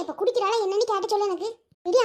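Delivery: ordinary talk, nothing marked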